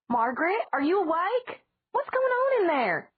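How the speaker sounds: high, loud call from upstairs; calling aunt. A narrator's reading. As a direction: call up from the stairs.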